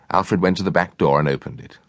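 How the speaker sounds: plain reading voice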